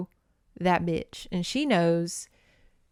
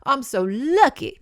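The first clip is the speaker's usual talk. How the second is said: much higher, gushing Southern big guy; lightly voiced delighted impresario; Big Daddy impression; 7 semitones higher than usual. Director greeting the singers, gushing. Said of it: "Big Daddy John Goodman vibes".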